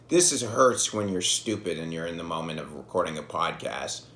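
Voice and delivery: ordinary talk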